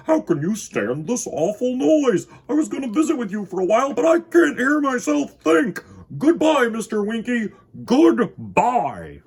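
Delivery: high, loud complaining voice; grumpy Mister Glum. Acted. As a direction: shout the complaint over the ticking clocks. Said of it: "said Mister Glum".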